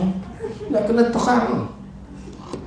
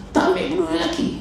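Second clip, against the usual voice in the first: "Tak ambil peduli lelaki" said much higher, mocking; 10 semitones higher, faster than usual. Shown as a demonstration. Speaker mocking a woman ignoring her husband, pitched high.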